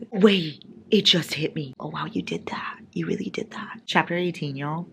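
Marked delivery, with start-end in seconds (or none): 1.8-3.8 breathy disbelieving whisper; stunned whisper. Real, not acted. Reader whispering to the author after a shock twist.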